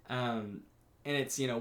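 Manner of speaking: usual speaking voice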